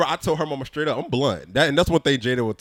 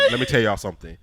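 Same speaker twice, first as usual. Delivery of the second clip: ordinary delivery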